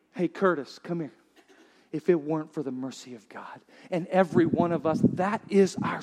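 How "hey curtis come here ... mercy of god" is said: the Lord, tender. Acted. The Lord greeting Curtis.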